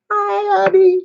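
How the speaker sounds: put-on high, sing-song baby talk to a pet; sweet pet talk. Greeting her pets sweetly.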